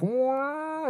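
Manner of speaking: airy high wow like a far-off guitar; distant high wow. Retold or imitated. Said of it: "like, distant".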